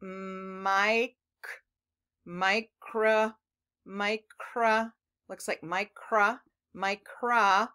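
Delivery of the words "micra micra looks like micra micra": "micra" with a long i, my-kra; long i reading. Shown as a demonstration. Teacher correcting to a long i for kids. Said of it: "is actually a long I".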